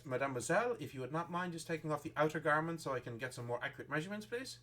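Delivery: put-on French accent. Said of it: "and she says".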